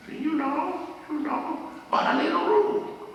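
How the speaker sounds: high-pitched student protest; pleading student. Retold or imitated. Voices student pleading for a rule.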